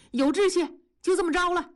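high, excited exclamation; delighted approval. Acted. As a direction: cheer the decision on, pitched well up. Husband delighted by wife's ambition. (高声兴奋地赞同)

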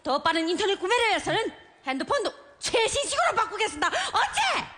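defiant. Acted. Defiant wife dictating message.

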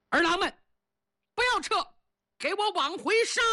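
high, rallying war cry; rally the troops to turn back; battle command. Acted. Chiyou ordering his men to charge back, rallying shout.

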